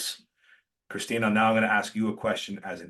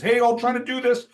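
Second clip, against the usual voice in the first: high, loud and hurried; cheerful cover for chaos; frazzled upbeat hello; 14 semitones higher, 8 dB louder than usual. Retold or imitated. Rushed car call, data cutting.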